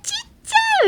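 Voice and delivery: extremely high squeal; adoring squeal. Shown as a demonstration. Looking at baby toenails, extremely high squeal. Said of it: "この世の周波数ぶっちぎるみたいな感じのイメージの声".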